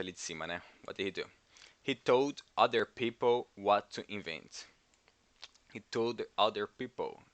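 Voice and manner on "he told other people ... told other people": slow, careful reading of the line. Shown as a demonstration. Teacher slowing down the fast movie line for students. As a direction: spell it out slowly for learners. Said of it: "ele fala muito rápido no começo".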